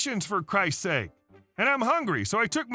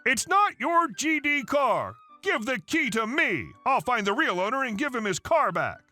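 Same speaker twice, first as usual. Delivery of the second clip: angry mother; 6 semitones higher than usual. Acted. Mother grabbing key.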